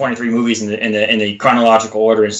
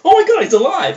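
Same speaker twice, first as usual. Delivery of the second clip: much higher, gushing surprise; mock amazement; faster than usual. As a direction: squeal with delight at the news. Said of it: "He goes".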